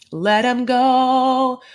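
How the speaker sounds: slow, lofty mock farewell; dramatic farewell. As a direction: draw out each word like a solemn goodbye.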